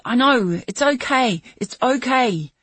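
loud, high soothing shout. Acted. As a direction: yell reassurance through the glass. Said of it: "I shouted".

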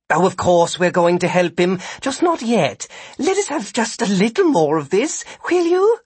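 high character voice, rising to a plea at the end; Sid, shamed but amused. A narrator's reading. Sid excusing himself to Vikram while watching the fight, shamefaced pleading.

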